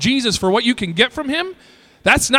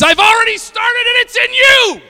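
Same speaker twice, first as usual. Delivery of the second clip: much higher, louder, declaring shout; booming shout; 6 dB louder than usual. Acted. God answers the idle church with a shout. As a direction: thunder it out as God's reply.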